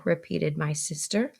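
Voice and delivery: usual speaking voice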